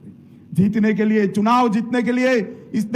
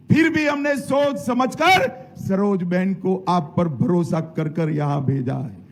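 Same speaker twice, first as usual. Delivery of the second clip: usual speaking voice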